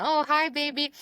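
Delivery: put-on high baby-talk greeting; lilting high hello; cooing at a baby. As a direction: coo sweetly to a baby. Greeting a little baby she sees.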